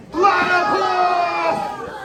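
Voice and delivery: long stretched high shout of the answer; crowd shout. Shown as a demonstration. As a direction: lead the crowd in a big drawn-out yell. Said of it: "They can be louder".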